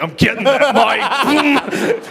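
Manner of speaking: high, loud, drawn-out comic threat; mock mic grabber. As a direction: imitate someone lunging greedily for it, laughing. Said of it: "He's gonna, it's like".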